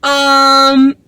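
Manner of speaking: high-pitched, slow, overly friendly small talk; hesitant, hammed-up high voice; awkward. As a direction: imitate someone awkwardly trying to win a kid over. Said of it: "like, the most awkward".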